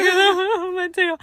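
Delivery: high, loud crying cry of the name; a hammed-up teary wail; retold sobbing. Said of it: "I started bawling, crying".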